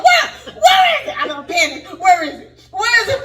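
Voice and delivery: high, loud frantic cries of where; panicked yell. Retold or imitated. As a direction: shriek in panic hunting the frog. Panicked asking about frog.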